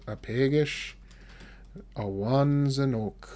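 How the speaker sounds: slow, split into two parts; slow model phrase. Shown as a demonstration. Teacher splitting Apegish Awanzanuk slowly for the class. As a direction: break it up slowly for learners. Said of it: "teacher models the phrase slowly".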